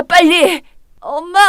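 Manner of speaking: usual speaking voice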